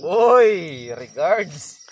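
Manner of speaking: sudden loud shout. Real, not acted. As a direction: yell it out. Loud joking outburst at friend.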